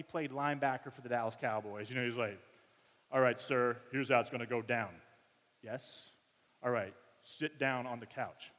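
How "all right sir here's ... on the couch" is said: big tough dude. Retold or imitated. Voicing the big linebacker-like dude.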